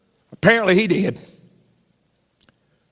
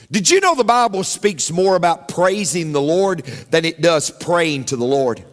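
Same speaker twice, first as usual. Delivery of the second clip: ordinary talk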